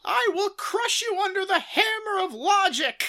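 joining in the mock debate bluster, high and loud; mock challenge shout. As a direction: yell it like a cartoon blowhard. Finishing the mock libertarian rant.

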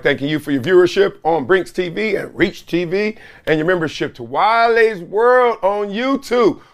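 ordinary talk

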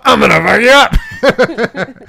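high, loud, laughing mock thug threat; tough guy voice. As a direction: ham up a street tough picking a fight.

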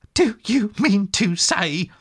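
slow, high, excited question. A narrator's reading. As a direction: lean in with eager disbelief. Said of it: "cried the excited rat".

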